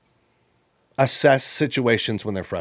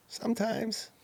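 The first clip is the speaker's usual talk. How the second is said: hesitant soft answer; 13 semitones higher, 10 dB quieter, slower than usual. Retold or imitated. Retold employee's hesitant answer.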